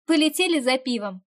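loud, laughing triumphant call. A narrator's reading. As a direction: crow it out in triumph. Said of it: "торжествующе рассмеялась".

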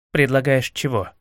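usual speaking voice

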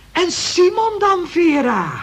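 high, loud, almost crying out; shocked. Acted. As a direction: cry out in dismay. Reacts to Vera's confession. (hoog, luid en ontzet)

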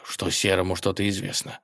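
plain reading voice

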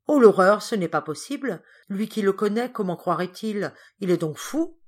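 a maid aghast at the news; horrified servant. Acted. Pélagie hearing the accusation, aghast outcry.

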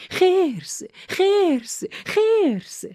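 very high, loud desperate yells; full-force frightened shout; shouting with all her might. A narrator's reading. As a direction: scream the word to scare the bear off. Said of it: "با نهایت قدرتش فریاد زد".

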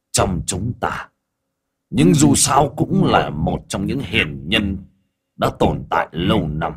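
lightly voiced demon elder, reproachful; mild character voice urging an opponent to stop. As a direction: start subdued, build into a challenge.

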